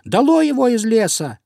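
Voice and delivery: high, loud little mouse protest; squeaking indignant mice. A narrator's reading. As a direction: argue back in a piping mouse voice. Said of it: "запищали мышки".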